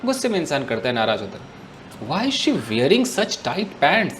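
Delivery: ordinary delivery, nothing marked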